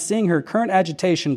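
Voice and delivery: plain reading voice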